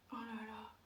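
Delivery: ordinary delivery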